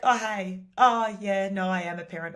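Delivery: imitating a relaxed, open New Yorker; breezy upbeat reply. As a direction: answer like you're happy to be stopped. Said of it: "most people are like".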